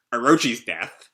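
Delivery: normal talking voice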